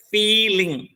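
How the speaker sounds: "feeling" with a long, stressed FEE and light "ling"; stress on first syllable. Shown as a demonstration. Teacher showing the stressed syllable, "feeling" said FEE-ling. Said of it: "syllable is stressed".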